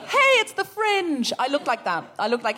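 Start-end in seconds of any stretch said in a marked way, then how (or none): 0.1-1.3 high, slow sing-song hey; childlike cheer. Retold or imitated. Greeting passers-by with starfish arms, high, slow childlike cheer. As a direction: beam like a child about to burst into song. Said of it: "like I was about to burst into song".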